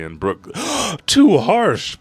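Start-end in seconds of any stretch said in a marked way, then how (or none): 0.5-1.0 a high startled gasp; sharp gasp. Retold or imitated. Brooke gasping at the king's death sentence, high and startled. 1.1-2.0 high, loud protest; pleading cry. Retold or imitated. Brooke crying out to the king for mercy, high and loud.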